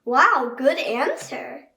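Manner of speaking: cheerful teacher praise; slow delighted praise. Acted. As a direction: praise the child warmly and slowly. Praising child's answer.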